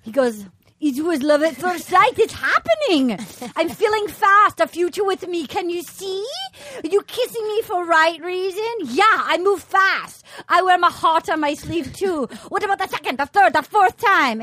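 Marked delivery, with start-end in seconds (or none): 0.8-12.3 higher-pitched, lovestruck suitor impression; hammed-up romantic declaration; Benoit. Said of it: "So then we have Benoit. He goes". 12.5-14.4 higher, insistent suitor impression; hammed-up pleading with punched ordinals; Benoit. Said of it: "So then we have Benoit. He goes".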